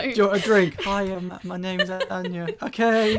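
high, loud acted exchange; mock awkward meeting. Tom acting out an awkward reunion, loud and high. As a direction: play both sides of a clumsy hello.

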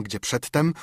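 usual speaking voice

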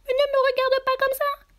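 very high, loud little rabbit voice; crying out. Acted. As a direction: cry out as a distressed rabbit. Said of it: "s'écria le lapin". (voix suraiguë de lapin qui s'écrie)

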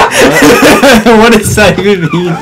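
laughing it out; cracking up mid-question. Real, not acted. Bursts out laughing at joke.

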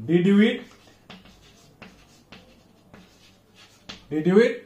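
did you eat, lifting at eat; rising question tone. Shown as a demonstration. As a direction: end did you eat on an upward tune. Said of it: "Yes no question".

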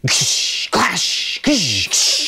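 hissing ksh like a cymbal crash; imitated cymbal clash; cymbal crash noise. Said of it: "When he needs to get your attention".

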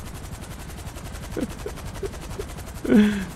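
long, soft wheezing laugh; breathless, voiceless cracking up; helpless laughter. Real, not acted. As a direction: laugh until the voice disappears. Laughs at the character's staged act.

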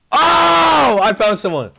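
high, loud yell of discovery; excited shout. Real, not acted. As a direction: shout it out, thrilled to find them. Spots hidden player in game.

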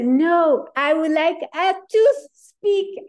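put-on husband corrects the waitress, French-accented. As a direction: insist on "a to speak" like a flustered Frenchman. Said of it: "And so he says".

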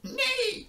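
high, falling whinny; imitated high-pitched neigh; horse neigh. A narrator's reading. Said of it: "said the horse".

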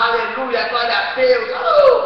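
loud, rising praise shout ending in a high whoop; imitated worship shout. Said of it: "outros começam a gritar".

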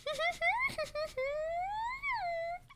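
long, high soft giggle. Real, not acted. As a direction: let out a delighted squeak of a laugh. Laughing at anime.

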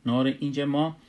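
usual speaking voice

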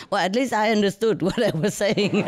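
self-mocking chuckle through the line; real laugh breaking in. Real, not acted. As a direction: laugh at your own expense. Laughing at herself.

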